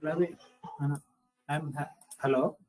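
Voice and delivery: faint, far from the mic; words barely coming through; barely audible. Real, not acted. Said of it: "Your voice are very low".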